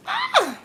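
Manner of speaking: sudden loud, very high yelp; high squeal. Real, not acted. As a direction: let out a short high shriek. Sudden cry while showing activity.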